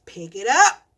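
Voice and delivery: loud, firm command; retold order. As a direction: bark the order at the kid. Ordering the toddler.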